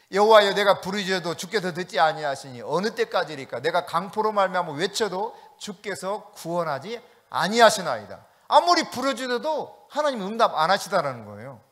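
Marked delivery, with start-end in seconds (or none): 0.1-8.2 louder, crying-out reading of the lament; lamenting prayer. Acted. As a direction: read it as a desperate cry to God. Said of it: "하박국 일 장 이 절 시작". (하나님께 부르짖는 탄식 기도처럼 크게)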